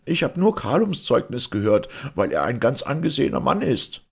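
high, loud desperate excuse; wailing. A narrator's reading. Police judge excusing himself to the Caliph, wailing. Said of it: "heulte der Polizeirichter".

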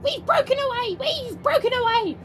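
very high, squealing chant; gleeful runaway trucks. Acted. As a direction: crow it in a tiny squeaky voice. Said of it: "they laughed".

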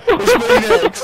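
nervous squeak. Real, not acted. Dreading taking the penalty.

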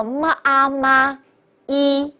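么, 啊, 嘛, then a separate 一, slowly; sounded out separately. Shown as a demonstration. Teacher shows the two vowels must be split, slow syllable-by-syllable. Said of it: "所以呢要分开".

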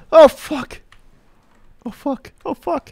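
high squealing dismay; shocked cry. Real, not acted. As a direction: yelp it in alarm. Repeated high exclamation.

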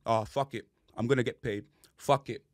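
low, soft mocking of a lazy player; mock lazy mumble. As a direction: drop it low and careless, imitating him. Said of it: "And he just walks in".

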